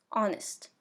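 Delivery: "Honest" said as ON-ist, H dropped; silent H. Shown as a demonstration. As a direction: start "Honest" straight on the vowel, no H sound. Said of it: "on ne le prononce pas".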